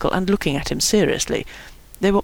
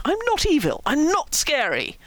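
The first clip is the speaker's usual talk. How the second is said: wounded, pitched-up insistence; aggrieved protest; 8 semitones higher, 3 dB quieter than usual. A narrator's reading. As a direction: plead innocence, stretching the words. Kevin protests being made the villain.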